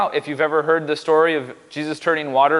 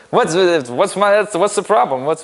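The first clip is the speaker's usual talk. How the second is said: put-on half-hearted New York accent, louder and higher; attempted East Coast accent; 4 semitones higher, 4 dB louder than usual. Pastor imagines Jesus as a New Yorker, badly faked East Coast accent. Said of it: "I can't do a, an East Coast accent".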